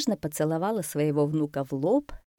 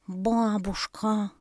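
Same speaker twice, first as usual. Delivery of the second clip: slow, higher awestruck exclamation; gasped amazement; 4 semitones higher, much slower than usual. A narrator's reading. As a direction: gasp it out, vowels stretched. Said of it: "ахнул слонёнок". (изумлённо, протяжно ахнув)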